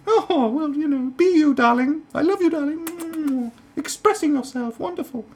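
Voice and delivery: very high, fluttery falsetto mother; hammed-up indulgent cooing; doting mum. Liberal mum approving her rebellious kid, gushing falsetto.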